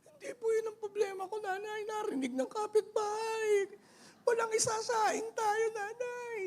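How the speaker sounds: very high, squeaky tearful whine; mock crying child. Child worried the neighbours heard there is no rice, mock crying. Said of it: "Huwag kang umiyak".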